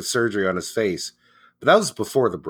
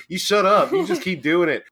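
mock snap; 5 semitones higher, 4 dB louder than usual. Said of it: "He's like".

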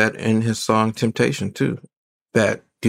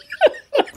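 short laugh. Real, not acted. Amused at own point.

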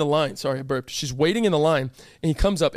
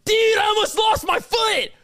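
high, slow hammed-up wail; overdramatic; 3 dB louder than usual. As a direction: play it like a drama queen. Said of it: "if I was dumb dramatic".

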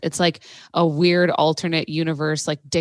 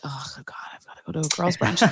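whispered, much softer groan of dread; 8 dB quieter, faster than usual. Retold or imitated. Dreading being dragged to girls' brunch, whispered. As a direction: whisper it like a secret complaint.

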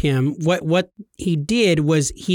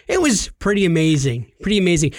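normal talking voice

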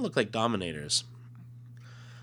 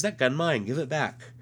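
put-on childlike voice; 7 semitones higher, 4 dB louder than usual. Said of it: "He says".

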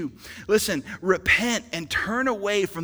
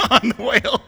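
laughing; 9 semitones higher, 9 dB louder than usual. Real, not acted. Joke about cursing the whale.

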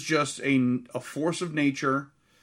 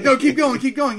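loud, high, laughing mock director call; director urging on; 10 semitones higher, 8 dB louder than usual. Host imitates director off screen, loud laughing urging. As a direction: shout it like an off-screen director egging them on. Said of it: "as he's like".

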